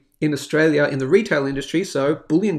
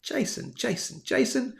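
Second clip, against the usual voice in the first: soft, higher, breathed repeated name; hushed mock chiding; 7 semitones higher, 7 dB quieter than usual. Host to viewer doubting his snake buying, hushed mock chiding. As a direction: chide him softly, as if he should know better.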